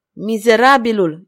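loud, indignant exclamation; angry outburst. A narrator's reading. Father cursing the man who threatens his daughter, angry outburst. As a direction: burst out at the villain. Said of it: "izbucni Tribule".

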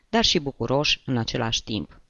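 ordinary delivery, nothing marked

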